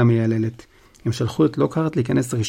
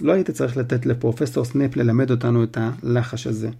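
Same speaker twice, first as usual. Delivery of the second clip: ordinary delivery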